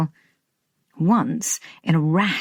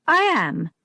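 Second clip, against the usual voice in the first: firm; 6 semitones higher than usual. Acted. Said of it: "lips close in a firm line".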